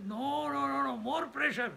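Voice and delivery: high-pitched, protesting voice for the mind; mock refusal from an unruly mind; the mind talking back. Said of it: "this fellow says".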